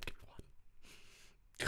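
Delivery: slow, barely voiced whisper; whispered plea. Real, not acted. Streamer begging for one survivor, whispered. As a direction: beg under your breath, slowly.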